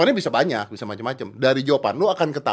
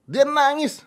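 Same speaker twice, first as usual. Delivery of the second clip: high, louder disbelieving repeat; incredulous exclaiming; 5 dB louder, slower than usual. Real, not acted.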